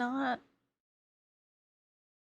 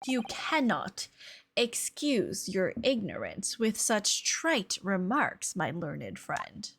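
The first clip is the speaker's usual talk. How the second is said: slow, lower-pitched haughty scholar; pompous lecturer; 6 semitones lower, 4 dB quieter, slower than usual. Acted. Lecturer reproving a learned friend's ignorance, slow and haughty. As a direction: scold slowly, sinking lower on the end.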